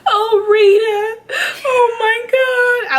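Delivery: high, loud, slow wailing sob; sobbing over Rita. Retold or imitated. Mourning Rita's death on the TV show, wailing. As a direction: cry out her name in grief. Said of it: "I was crying so hard".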